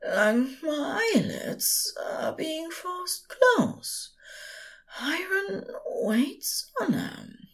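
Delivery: slow, high, fading to a whisper; drowsy slur. A narrator's reading. Drunk angel falling asleep beside the demon, whisper-slurred. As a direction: drift off mid-sentence, slurring. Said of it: "voice starting to slur".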